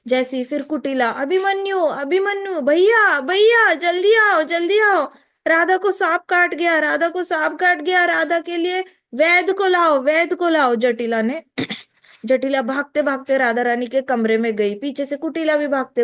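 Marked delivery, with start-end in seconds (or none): 1.1-10.7 frantic calling. Acted. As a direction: scream for help, the bride is bitten. Kutila screaming for brother. (घबराई बहन भाई को ज़ोर से पुकारती है)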